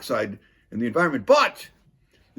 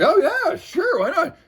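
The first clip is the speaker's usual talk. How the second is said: mock high voice; 14 semitones higher, 3 dB louder, slower than usual. Mocking the council agreeing.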